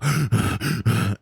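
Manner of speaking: fast, heavy character huffing; rapid panting. Shown as a demonstration. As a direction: huff hard and fast, over and over. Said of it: "I go".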